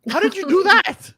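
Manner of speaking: loud, high incredulous question; real astonishment. Real, not acted. Friend reacts to someone drinking cocaine, loudly astonished.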